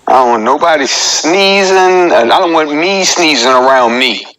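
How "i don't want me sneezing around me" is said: ordinary talk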